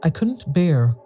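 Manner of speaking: ordinary delivery, nothing marked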